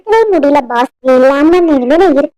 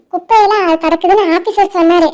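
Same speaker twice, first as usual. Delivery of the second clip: usual speaking voice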